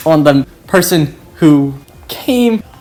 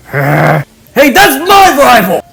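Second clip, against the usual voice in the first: loud, higher angry yell; angry shout; 8 semitones higher, 5 dB louder than usual. Acted. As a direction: bellow at the newcomer. Bakugo angrily joins fight.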